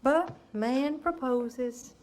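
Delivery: slow, weighted quote of a saying; slow quip. Acted. Mother quoting the old saying to the caller, slow. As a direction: lean on each word, slowly.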